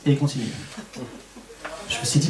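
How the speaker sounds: ordinary talk, nothing marked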